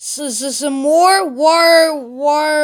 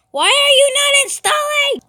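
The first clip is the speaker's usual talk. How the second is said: much higher exasperated whining question; frustrated whine; 12 semitones higher, 3 dB louder than usual. Real, not acted. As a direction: complain to the screen. Frustrated at stalled install.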